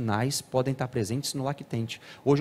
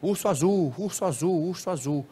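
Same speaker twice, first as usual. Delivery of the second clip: lightly imitated cartoon voice on a loop; repetitive cartoon echo; 5 semitones higher than usual. Shown as a demonstration. Lecturer shows a child repeating a cartoon all day, looping cartoon echo. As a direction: parrot a cartoon phrase over and over. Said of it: "fica repetindo aquela voz do desenho".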